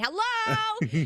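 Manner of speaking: high hyped-up hello; too much caffeine. Shown as a demonstration. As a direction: hit it wired and bouncy. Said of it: "this is me on caffeine today".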